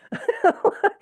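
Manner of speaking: high laughing burst; real high laugh; burst of laughter. Real, not acted. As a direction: laugh at the routine. Laughing at triage routine.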